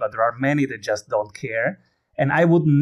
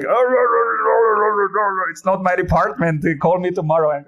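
higher-pitched dismissive customer service parody; mock brush-off; 5 semitones higher, faster than usual. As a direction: imitate an unhelpful agent fobbing someone off. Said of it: "It was more like".

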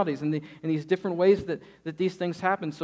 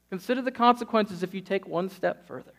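ordinary delivery

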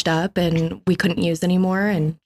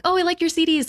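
put-on young customer voice; 10 semitones higher, faster than usual.

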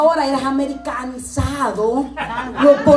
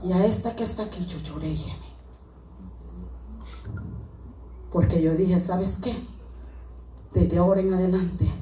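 low, much softer prophetic speech; hushed prophetic voice; 11 semitones lower, 10 dB quieter than usual. Acted. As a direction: speak quietly as if under the anointing. Quiet anointed prophetic passage.

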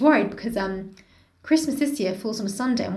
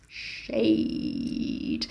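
whispering; 6 dB quieter than usual. Real, not acted. Whispered aside.

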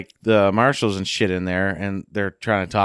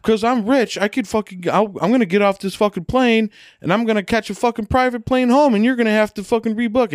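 mock Odell rant; 11 semitones higher than usual. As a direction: mimic Odell's arrogant outburst, pitched way up. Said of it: "Odell's like".

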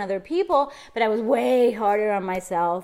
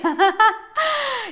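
high laugh; 11 semitones higher, 3 dB louder than usual. Real, not acted. Laughing after her song.

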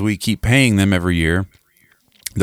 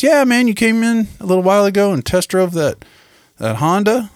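over-friendly salesman; 13 semitones higher than usual. Retold or imitated. Salesman reintroducing himself by phone, mock-friendly high voice. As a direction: imitate an eager salesman in a put-on high voice. Acts out salesman reminding him.

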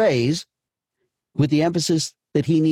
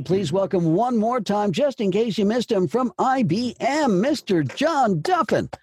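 raised, slowed announcer welcome; announcer intro; 6 semitones higher than usual. Acted. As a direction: bring him on like an emcee. Host introduces the speaker.

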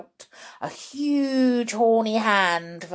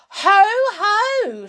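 high, loud mock laugh; crowing cry; 12 semitones higher, 9 dB louder than usual. Grandma catches George with a cup, crowing loudly. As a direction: cry out in gleeful accusation. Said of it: "she cried".

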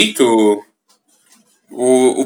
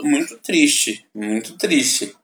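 softer, slow and sad; 8 dB quieter, slower than usual. Real, not acted. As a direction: let it fade, saddened. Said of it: "realmente é um negócio muito".

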